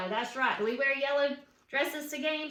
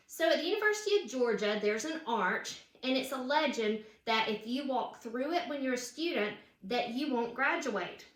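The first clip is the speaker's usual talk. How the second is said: plain reading voice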